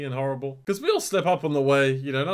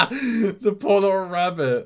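laughing; 7 semitones higher, 8 dB louder, slower than usual. Real, not acted. Cracking up at the scene.

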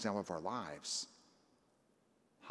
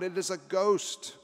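mock cry of terror, higher and louder; terrified cry; 8 semitones higher, 6 dB louder, slower than usual. A narrator's reading. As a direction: cry out like frightened disciples. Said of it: "they're terrified and they cry out".

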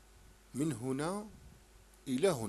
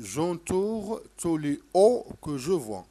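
slow dictation; 3 semitones higher, 5 dB louder, much slower than usual. Shown as a demonstration.

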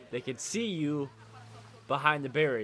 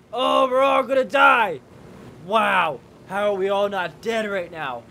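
high, loud panicked yell; panicked shouting; 9 semitones higher, 13 dB louder, slower than usual. Real, not acted. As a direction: scream like you're about to crash. Helicopter nearly crashes.

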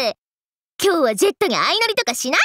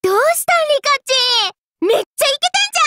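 high-pitched, gleeful greeting; bubbly, high-energy shout-out; excited calling out; 8 semitones higher, much faster than usual. Acted. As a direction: hail friends with squealing enthusiasm. Spots friends and calls out excitedly.

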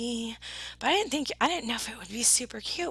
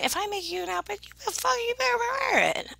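very high, squeaky-pitched telling-off; high mock-indignant demand; playful scolding; 10 semitones higher, much faster than usual. Real, not acted. Playfully scolding over unworn outfit.

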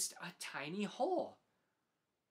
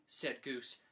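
ordinary delivery, nothing marked

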